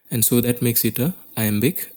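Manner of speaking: ordinary talk, nothing marked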